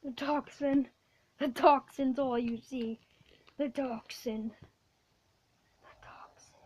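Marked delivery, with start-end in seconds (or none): 5.8-6.5 breathy whisper. Real, not acted. Whispered aside.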